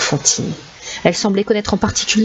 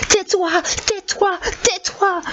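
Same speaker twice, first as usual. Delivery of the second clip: high repeated screams; screaming; 13 semitones higher, slower than usual. Acted. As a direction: scream over and over. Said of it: "il hurla encore et encore". (hurlements aigus répétés)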